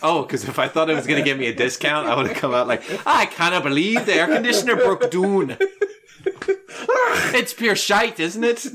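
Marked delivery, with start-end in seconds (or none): 3.1-8.7 put-on Glasgow complaint, pitched up with a laugh; Scottish accent. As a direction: fake a Glaswegian grumble for a discount. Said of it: "sounds like he grew up in the Toonies in Glasgow".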